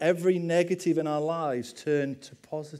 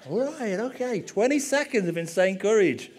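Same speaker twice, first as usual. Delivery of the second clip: higher-pitched, mock nervous resolve; psyching himself up; 6 semitones higher, 4 dB louder than usual. Preacher retelling his nerves before street evangelism, psyching himself up. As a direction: talk yourself into it, pitch lifted.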